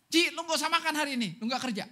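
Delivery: loud, high, cutting shout at the sister; a brother shouting at his older sister that she gets no food; harsh sibling scolding. Retold or imitated. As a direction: yell at her like a bossy little brother.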